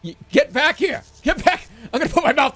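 high, loud calling out; shouting. Real, not acted. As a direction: shout after it. Chasing something in game.

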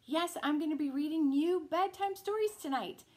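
plain reading voice

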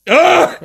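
loud, high frustrated "ah"; hammed-up groaning shout; mock exasperated yell. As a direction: imitate dad's fed-up outcry. Exasperated dad at the shower.

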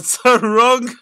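laughing through the words; real laughter. Real, not acted. Podcaster laughing at criticism of his pronunciation, amused.

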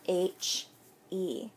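usual speaking voice

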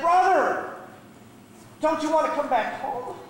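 urgent appeal. Acted. Older brother phoning the runaway, pleading. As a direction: plead with him, voice lifted high.

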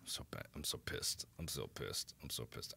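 soft, almost whispered grumble; muttered frustration. Real, not acted. Streamer mutters that he's pissed, very soft. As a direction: grumble it under your breath.

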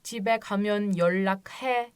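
yollak he yavaş, sonda he uzatılır; slow drama line. Shown as a demonstration. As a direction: dizideki gibi yavaşça söyle. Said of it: "eve gidersen ara derler ya hani". (yollak he, dizi repliği gibi yavaş)

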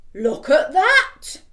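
slow, loud, laughing; laughing big skeleton. Acted. Big skeleton laughs at dog, slow and loud. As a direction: chuckle it out slowly.

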